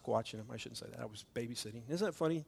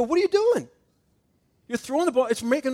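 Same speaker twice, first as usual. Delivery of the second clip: much higher and louder, a dad at the end of his rope; exasperated shout; 10 semitones higher, 10 dB louder than usual. Retold or imitated. Father catches his son throwing the ball again, shouting exasperated. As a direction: burst out at the kid mid-sentence.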